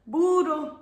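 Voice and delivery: burro said high and clear, BOO-rro, rolled r, falling; Spanish word model. Shown as a demonstration. Practice burro with children, clear high model. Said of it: "Let's practice saying burro".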